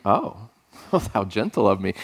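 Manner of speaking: low, softened aside with a laugh; soft retold reaction. Dad reacts to his daughters' answer, softly amused.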